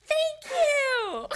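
very high, loud shout with laughter; yelling back. Retold or imitated. Answering the friend across the dance club, laughing yell. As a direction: holler it back over the music. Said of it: "and I yelled back".

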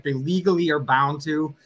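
normal talking voice

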